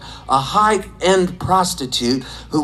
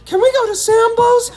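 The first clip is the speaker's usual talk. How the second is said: put-on very high, eager child's plea; kid voice; 3 dB louder than usual. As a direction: ask like an excited little kid. Kid asking parents.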